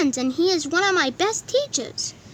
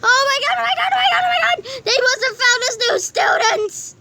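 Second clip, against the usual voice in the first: excited shouting; 8 semitones higher than usual. Acted. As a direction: gush over the news, high. Excited about letters.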